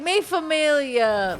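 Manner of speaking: slow, high and loud "mi familia", hammed up; drawn-out "mi familia" with a heavy stress on "mi"; mock movie tough guy. Streamer hams up a family toast, slow and loud.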